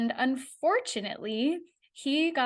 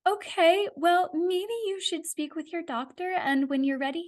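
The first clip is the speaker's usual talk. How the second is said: much higher voice, tactful backing off; imitated awkward scheduler; 8 semitones higher than usual. Scheduler deferring to the patient's doctor, imitated awkward tact. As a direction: imitate a caller delicately sidestepping bad news.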